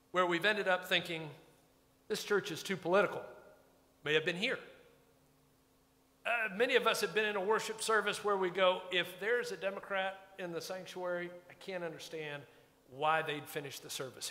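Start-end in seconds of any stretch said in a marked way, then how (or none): none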